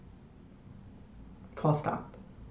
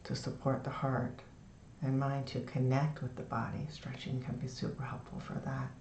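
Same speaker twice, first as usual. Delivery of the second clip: soft, low, soothing meditation voice; guided meditation; 6 semitones lower, 10 dB quieter than usual. Shown as a demonstration. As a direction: ease them into the body with long pauses. Leads a lying-down meditation.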